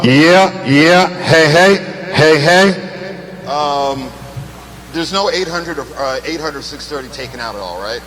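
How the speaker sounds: ordinary delivery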